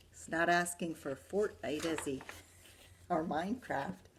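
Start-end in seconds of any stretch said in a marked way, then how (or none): none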